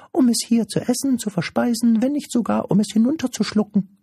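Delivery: very high, quick, pedantic creature voice; squeaky little know-it-all creature; cheeky little creature. Acted. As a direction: pipe it up fast and cheekily.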